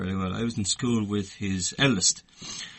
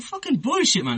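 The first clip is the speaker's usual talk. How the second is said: high, loud cry of disbelief; schoolboy refusing to believe it; mock disbelief; 4 dB louder than usual. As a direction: scoff like a stunned kid.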